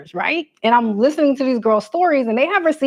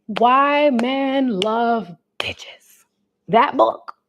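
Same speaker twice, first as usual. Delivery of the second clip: whispering; much slower than usual. Real, not acted. Whispering a swear-word book title.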